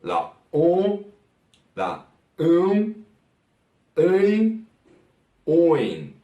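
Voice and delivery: demonstrating open Portuguese vowels one by one. Shown as a demonstration. Teacher showing students the vowels, careful vowel demo. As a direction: sound out each vowel clearly for the class. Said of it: "nosotros también tenemos la E".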